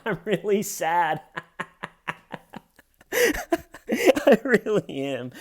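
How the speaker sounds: giggling mock sad; laughing while claiming sadness. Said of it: "she's just laughing the whole time".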